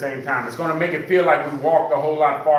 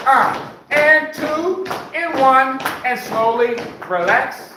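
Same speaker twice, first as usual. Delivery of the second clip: high, loud, peppy workout cueing; aerobics instructor; 11 semitones higher, 4 dB louder, slower than usual. Acted. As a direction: pump the class up like a fitness coach. Leads the marching cardio.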